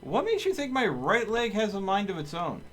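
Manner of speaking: high, loud baffled disbelief; what-the-heck incredulity; incredulous. Real, not acted. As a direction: react to a ridiculous chat question. Baffled reaction to chat request.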